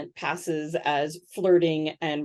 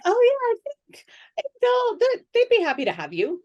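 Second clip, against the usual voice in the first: much higher, breezy voice of an eager acquaintance; friendly contact imitated; 4 dB louder than usual. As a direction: play the well-connected friend, airy and obliging. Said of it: "were like".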